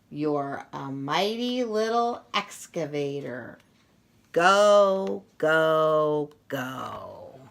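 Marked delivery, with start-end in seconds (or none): none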